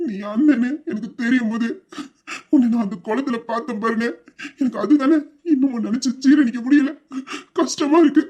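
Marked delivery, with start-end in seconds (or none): 4.6-8.2 breaking into tears. Acted. Younger brother recalling the pond, breaking into tears. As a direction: voice cracks higher into crying.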